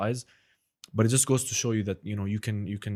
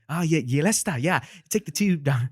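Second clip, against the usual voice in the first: loud, higher London street accent; a loud, bright-pitched London accent; 6 semitones higher, 5 dB louder than usual. Shown as a demonstration. Imitating a Londoner in a comedian's impression. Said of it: "that's how he would do that impression".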